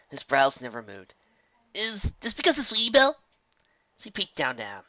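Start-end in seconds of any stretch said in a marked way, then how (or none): 1.7-3.2 higher, louder suspicious colt; young colt voice. Acted. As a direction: voice the wary young customer. Colt asks.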